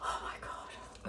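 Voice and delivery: slow, breathless whispered dismay; hushed disbelief; stunned whisper. Real, not acted. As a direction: whisper it in defeat. After the horrible taste.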